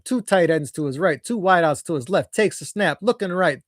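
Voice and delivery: normal talking voice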